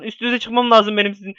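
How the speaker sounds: ordinary delivery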